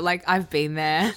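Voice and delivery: ordinary delivery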